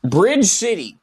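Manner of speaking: announcer voice. Pretend. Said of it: "file in here called Bridge City".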